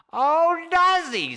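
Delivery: high cranky fisherman scoffing; sarcastic Charlie. Acted. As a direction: sneer back mockingly.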